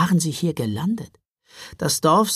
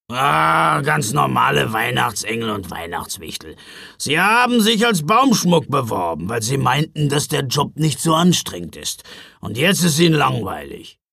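louder, weary gruff explanation; grumpy Knecht Ruprecht, exasperated; 4 dB louder than usual. Acted. Driver explaining bored tree elves to children, exasperated. Ruprecht answers, rolling his eyes.

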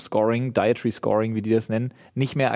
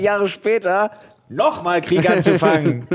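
slow comic build; 5 semitones higher, 4 dB louder, slower than usual. Retold or imitated. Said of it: "Comedy-Skit von diesem Typen".

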